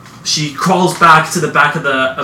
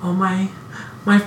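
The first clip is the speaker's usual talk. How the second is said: put-on little girl voice; 5 semitones higher than usual.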